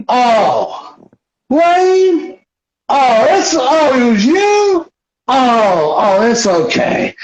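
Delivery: high, whimpering disbelief softening into forgiveness; mock astonished relief. Billy learns his friend drank it, astonished and forgiving. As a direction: squeal it like a wounded friend suddenly placated. Acted Billy, suddenly placated.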